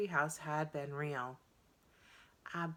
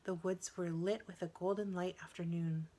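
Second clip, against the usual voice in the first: plain reading voice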